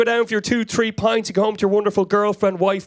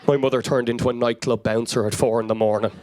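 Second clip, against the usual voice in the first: ordinary talk, nothing marked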